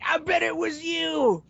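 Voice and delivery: slow, loud, high-pitched blame; drawn-out accusing holler; mock accusation. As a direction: point the finger in hammed-up outrage. Acted accusation, blaming someone.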